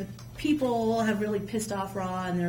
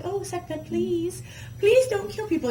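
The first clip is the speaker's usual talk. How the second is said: higher, pleading mock plea; pleading gods; 10 semitones higher, 4 dB louder than usual. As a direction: beg her sweetly like nervous gods. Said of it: "They're like".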